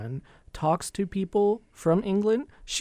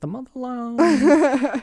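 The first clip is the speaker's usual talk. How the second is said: high, slow and grand; The motherland as a mock-solemn title; hammed-up reverence; mock grandeur; 9 semitones higher, 5 dB louder, much slower than usual. Joking about mom's England.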